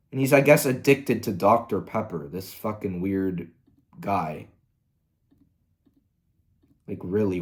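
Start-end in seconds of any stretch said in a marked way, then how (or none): none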